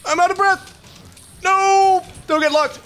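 very high, frantic squeal; panicked cry. Real, not acted. Player begging not to get locked mid-fight, high-pitched panic. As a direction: plead desperately as defeat looms.

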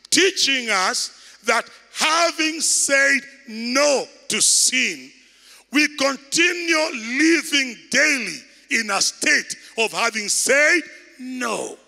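slow, emphatic preaching that swells into a shout; full-force preaching. Acted. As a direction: drive each word home to the congregation. Preacher emphasises the Greek meaning.

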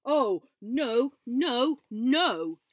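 storyteller's loud alarmed no; alarmed cry. A narrator's reading. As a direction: cry out in mock panic. Narrator exclaiming in alarm.

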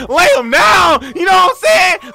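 loud, high victory shout; triumphant crowing. Real, not acted. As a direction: crow over the takedown. Crowing after surviving the attack.

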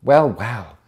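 loud, surprised exclamation; exclaimed. Acted. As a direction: react to the find out loud. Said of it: "exclaimed Sue".

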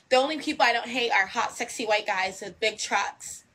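ordinary delivery

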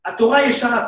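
ordinary talk

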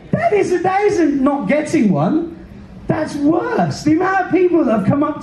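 indignant. Real, not acted. Indignant about veterans' welcome.

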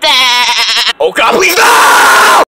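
loud, high, drawn-out plea; terrified scream. Acted. Victim begs the pickle hunter to stop, terrified. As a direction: scream for mercy, stretching the last word.

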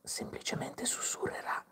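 unvoiced-breath stage whisper; hushed whisper to show how it sounds. Shown as a demonstration. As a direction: drop into a demonstrative whisper. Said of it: "se la voce sa di dover sussurrare".

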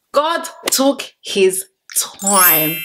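hushed, drawn-out praise; slow admiring whisper. Shown as a demonstration. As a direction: whisper "God took his time" slowly and in awe. Repeats it whispered, slowly.

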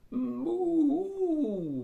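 high, loud cow bellow; bellowed moo. Acted. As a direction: bellow like a cow grabbing food. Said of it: "bellowed Belinda".

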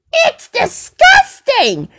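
very high, drawn-out disgusted outburst; exaggerated disgust. Reacting to Stinky Pete's farting, exaggerated disgust. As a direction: squeal it slowly in disgust, then drop.

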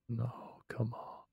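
normal talking voice

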